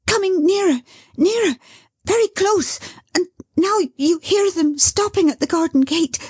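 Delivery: high, rising breathless thrill; breathless building. Acted. Dot counting down the son's arrival, breathless and high. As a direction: build as the steps come closer. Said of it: "so fluttered and distressed that she could scarcely breathe".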